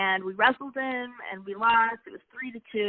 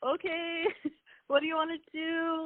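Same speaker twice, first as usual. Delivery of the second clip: much higher "Okay", with a laugh breaking into the words; amused humoring; 8 semitones higher than usual. Retold or imitated. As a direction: laugh through it while gently humoring him. Wife humoring husband's repeated venting.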